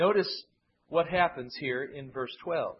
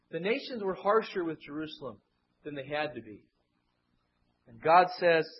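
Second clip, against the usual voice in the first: ordinary delivery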